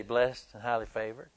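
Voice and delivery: low, soft rote church phrase; mock pious answer; Christianese lip service. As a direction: say it by habit, not meaning. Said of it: "I call it Christianese".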